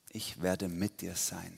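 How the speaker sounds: soft, slow divine assurance; quiet, unhurried reassurance; reassuring promise. Acted. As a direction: promise it slowly and softly, as God. Quotes God's promise softly.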